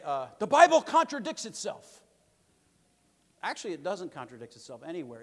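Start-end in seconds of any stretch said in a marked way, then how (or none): 0.4-2.0 loud, high mock claim of a skeptic; a skeptic's line, hammed up high and loud; mocking critic. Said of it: "they'll say".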